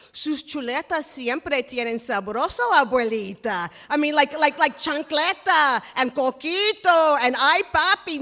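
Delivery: loud, high Caribbean Spanish phrases, slowed; loud kitchen Spanish to the boss. Retold or imitated. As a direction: rattle off home Spanish like an abuela.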